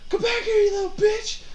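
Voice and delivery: high, loud shout after a fleeing enemy; in-character yell. Acted. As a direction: yell a taunt at someone running away. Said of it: "I'm yelling at him".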